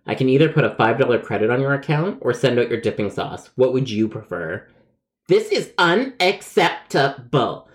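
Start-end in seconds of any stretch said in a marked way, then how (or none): 5.3-7.7 "unacceptable" spaced out slowly, pitched high; indignant. Acted. Caller refusing the offered credit, "unacceptable" slow and syllable by syllable. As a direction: punch each syllable of "unacceptable" with theatrical outrage. Caller's repeated outraged verdict.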